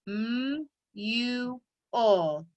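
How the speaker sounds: "M U L" as slow, separated letter sounds; sounded out. Shown as a demonstration. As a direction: stretch each sound of "M U L", pausing between them. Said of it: "Let's do the sound lines".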